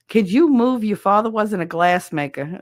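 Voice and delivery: loud, sharp imitation of grandma; scolding grandmother. Grandma to child in front of TV, scolding. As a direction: scold like a grandmother blocked from her TV.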